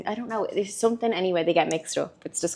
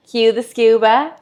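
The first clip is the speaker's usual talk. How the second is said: slow, echoey school PA announcement; intercom voice; 3 semitones higher, 6 dB louder, slower than usual. Pretend. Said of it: "Why do we sound like an intercom at school?".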